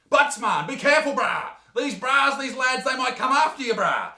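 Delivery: hammed-up street lad warning him off; eshay lad warning. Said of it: "Now you're probably thinking".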